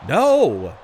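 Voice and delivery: loud shocked no; shout. Real, not acted. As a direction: yell it at the kickout. Near-fall kickout.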